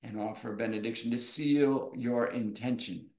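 normal talking voice

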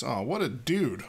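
usual speaking voice

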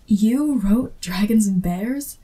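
normal talking voice

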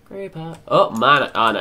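ordinary talk, nothing marked

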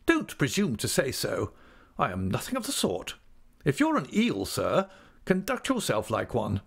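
high, emphatic scolding with swooping pitch; great indignation. A narrator's reading. Aunt orders the squirming clerk to keep still, indignant. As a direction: tell him off, climbing in outrage. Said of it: "said my aunt with great indignation".

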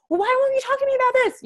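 high, fast whiny demand; mock exasperated plea. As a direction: play your past self begging him to talk. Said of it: "I'm like".

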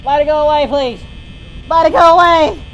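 loud pleading shout. Real, not acted. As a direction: yell and beg it away. Shouting at Bonnie.

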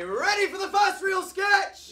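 high, loud excited shout. Acted. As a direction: hype the crowd up. Hyping up the first sketch.

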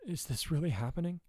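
hushed hoarse awe; hoarse wonder. A narrator's reading. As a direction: ask it hushed, in disbelieving wonder. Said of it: "Mina asked hoarsely".

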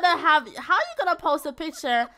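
loud, incredulous scolding with a laugh in it; exasperated rant. Real, not acted. Narrator ranting at his stupidity.

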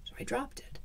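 hushed, breathless whisper. A narrator's reading. Whispered after second scream.

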